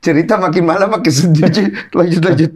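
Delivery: high, loud, laughing aside; laughing banter. Real, not acted. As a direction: joke through laughter, then urge them on. Bercanda soal cerita yang sedih. (berseloroh keras sambil tertawa)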